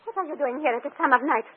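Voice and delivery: ordinary talk, nothing marked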